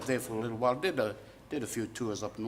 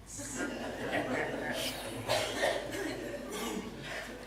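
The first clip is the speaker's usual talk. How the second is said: airy unvoiced chuckle; laughing at the waffling joke; breathy laugh; 4 semitones higher, 4 dB quieter than usual. Real, not acted. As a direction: let out a soft breathy laugh. Laughter after waffled on remark.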